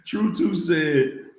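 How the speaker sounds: usual speaking voice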